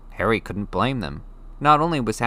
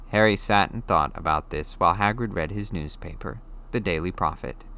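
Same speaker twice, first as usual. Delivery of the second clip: usual speaking voice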